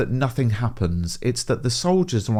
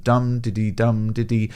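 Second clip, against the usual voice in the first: dum-diddy, dum-diddy with the dum hit hard and both diddy syllables light; galloping rhythm. Shown as a demonstration. Teacher showing students the dactyl beat, stress on dum. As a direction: tap out dum-diddy like hoofbeats. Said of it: "The regular rhythmic pattern of".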